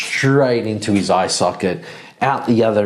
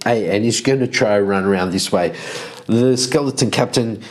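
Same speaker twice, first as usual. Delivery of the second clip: ordinary talk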